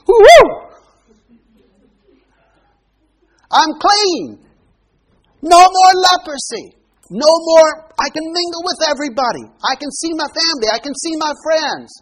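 high, loud whoop of joy; overjoyed shout of a healed man; joyful leper. Acted. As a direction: burst out like a man set free. Leper rejoicing at being cleansed.

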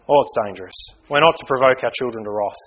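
usual speaking voice

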